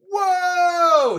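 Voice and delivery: high, drawn-out whoa; long high-pitched cry of amazement; shocked exclamation. Real, not acted. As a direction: react aghast, voice shooting up. Reacts to Lear's cruel line.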